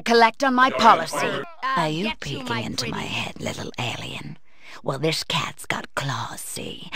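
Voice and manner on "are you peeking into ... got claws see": menacing whisper. Acted. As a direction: purr the threat close like a cat. Threatening a mind-reading alien.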